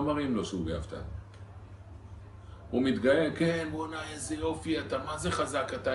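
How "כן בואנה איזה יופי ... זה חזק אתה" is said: mock flattery. Flatterers praising the proud man.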